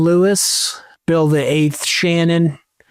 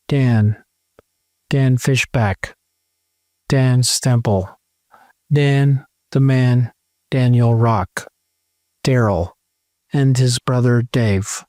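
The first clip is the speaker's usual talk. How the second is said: usual speaking voice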